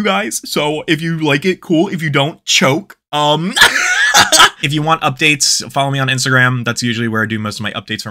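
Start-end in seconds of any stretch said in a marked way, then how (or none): 3.6-4.6 a high, loud cackle; burst of laughter. Real, not acted. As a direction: cackle at your own joke. Laughing at her own sign-off.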